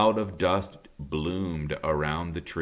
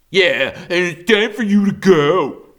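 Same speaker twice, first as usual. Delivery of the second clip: loud, high threatening growl; growling bear; 12 semitones higher, 12 dB louder than usual. A narrator's reading. Thorn threatening the robot, loud and menacing. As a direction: push them off the island with menace. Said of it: "growled Thorn".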